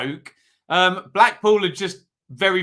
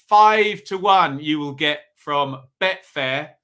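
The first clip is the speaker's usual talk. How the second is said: ordinary talk, nothing marked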